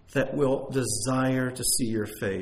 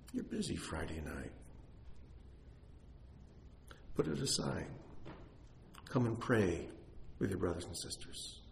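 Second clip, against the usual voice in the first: much softer, gentle inner call; still small voice; 3 semitones lower, 11 dB quieter than usual. Retold or imitated. Pastor voicing God's still small voice, soft and gentle. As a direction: speak it softly, like a gentle nudge. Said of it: "little still voice".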